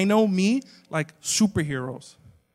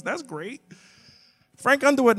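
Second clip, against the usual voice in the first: ordinary delivery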